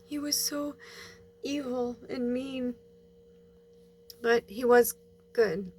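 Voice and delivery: fighting tears while telling it; holding back tears. Real, not acted. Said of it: "I'm trying not to cry right now".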